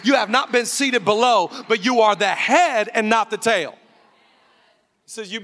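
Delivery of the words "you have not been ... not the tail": preaching at full force. Acted.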